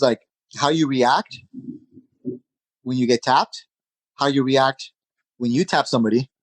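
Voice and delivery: ordinary talk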